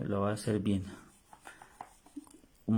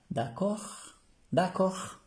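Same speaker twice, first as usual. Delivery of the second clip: much higher, sing-song "D'accord" with a rising end; a bright, rising French "d'accord"; 9 semitones higher, much slower than usual. Acted. Teacher voices French phrase. ("d'accord" muy agudo y cantado)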